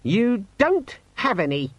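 high, slow, squeaky sulk; sulky demon. A narrator's reading. Demon reporting no appointments to owner, sulkily. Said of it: "said the demon sulkily".

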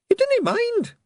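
very high, hopeful little Scots pixie; hopeful Scottish pixie. A narrator's reading. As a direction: ask hopefully like a tiny pixie.

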